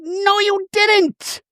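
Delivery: very high, very slow no you didn't; mock shrill denial. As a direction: stretch it out in outrage. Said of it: "Shannon's like".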